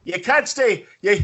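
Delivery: mock Irish bartender. Bartender hologram refusing a drunk in Irish accent. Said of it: "You can do an Irish accent".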